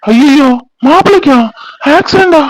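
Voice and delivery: high, drawn-out cries of alarm; shocked dismay. Acted. Brother hearing of brother-in-law's accident, shocked. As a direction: react aghast to terrible news.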